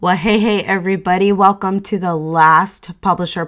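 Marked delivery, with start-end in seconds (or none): none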